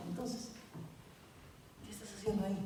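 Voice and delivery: hushed taunt. Acted. Devil taunting the man.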